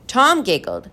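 ordinary delivery, nothing marked

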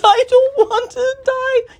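high, slow sobbing wail; loud, drawn-out crying plea; tearful moaning. Acted. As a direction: moan it out through tears. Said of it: "he moaned".

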